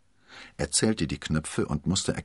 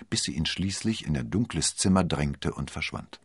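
usual speaking voice

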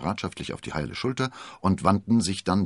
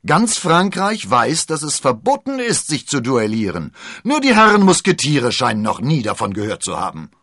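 scolding commander; 8 semitones higher, 11 dB louder than usual. Acted.

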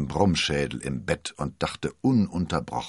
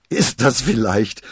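louder, slow, amazed; astonished question; 5 semitones higher, 9 dB louder than usual. A narrator's reading. D'Artagnan fragt Constanze verblüfft. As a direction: ask in disbelief. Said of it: "rief D'Artagnan".

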